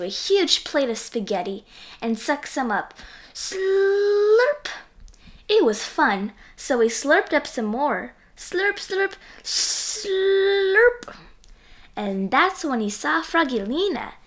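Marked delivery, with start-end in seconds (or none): 3.3-4.7 higher, louder slurp noise; 1 slurp sound. A narrator's reading. Slurping spaghetti. 8.4-11.2 higher slurping noises; 1 slurp sounds. A narrator's reading. Slurping spaghetti.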